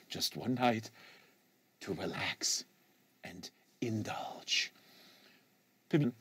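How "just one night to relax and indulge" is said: soft, slow, breathy wind-down; dreamy whisper. A narrator's reading. Student ending his daydream to teammates, fading to a slow whisper. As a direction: let it sink to a slow whisper of longing.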